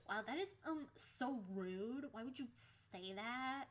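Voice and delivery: exaggerated scandalized schoolgirl impression; mocking girl voice. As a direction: imitate an indignant girl with swooping pitch. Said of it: "Why do people actually talk like this?".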